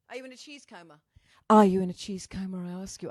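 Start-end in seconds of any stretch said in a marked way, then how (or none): 0.1-1.0 much softer, off-mic question; off the microphone. Real, not acted. Host asking a viewer about cheese, softly off-mic. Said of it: "I have to talk into the microphone". 1.5-2.6 loud question right into the mic; into the microphone. Real, not acted. Host repeating the cheese question to a viewer, loud on-mic. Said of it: "I have to talk into the microphone".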